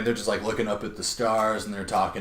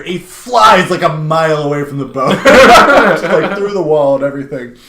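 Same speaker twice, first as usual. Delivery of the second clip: usual speaking voice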